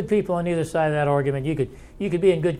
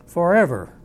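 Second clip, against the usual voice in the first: "forever" hit loud and falling; stressing forever; 3 semitones higher, 6 dB louder than usual. Shown as a demonstration. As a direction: lean hard on "forever". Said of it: "I'm trying to emphasize the word forever".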